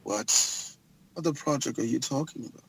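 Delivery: low, soft, menacing murmur; a villain quietly sizing up a prize; Dean Morris impression. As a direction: boss asks about the project, low and quiet. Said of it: "Here come Dean Morris".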